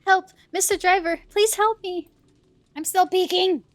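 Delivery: hammed-up cry for help; calling for help. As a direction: shout for the driver as if trapped. Voicing trapped Jane calling for help.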